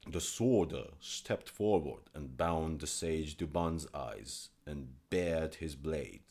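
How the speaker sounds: plain reading voice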